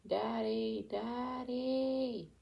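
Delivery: stretched high wail, held back in volume; screaming child, understated. A narrator's reading. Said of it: "Colton was still screaming".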